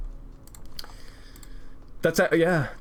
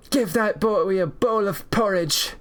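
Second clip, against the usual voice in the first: cheering crowd; 7 semitones higher, slower than usual. Retold or imitated. Cafeteria crowd cheering him on.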